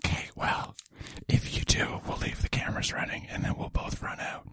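whispered aside; ASMR whisper. Pretend. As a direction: keep whispering close to the mic.

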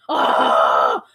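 long, loud acted "aah"; hammed-up scream of a man going over; mock scream. Podcaster replaying the driver's crash, mock scream. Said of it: "'Cause he goes".

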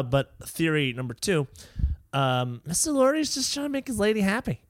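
ordinary talk

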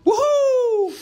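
loud, high celebratory whoop; excited whoop. Real, not acted. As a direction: whoop it out like a cheer. Sign-off cheer to listeners.

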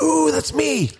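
very high, drawn-out excited imitation; mock delighted squeal. Listener hearing himself on the show, mock delight. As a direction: squeal it like a thrilled fan.

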